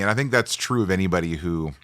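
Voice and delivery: ordinary talk, nothing marked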